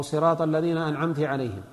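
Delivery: "أنعمت" said an'amti, ending in i; final i vowel on "أنعمت"; feminine-address error. Shown as a demonstration. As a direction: misread "أنعمت" with kasra on the ta. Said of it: "لأنه صار الخطاب للمؤنث".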